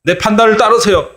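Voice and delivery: a mock stern leader putting people in their place; imagined scolding. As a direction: leader scolding critics, pitch climbing. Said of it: "막 이렇게 야단을 쳤을 텐데".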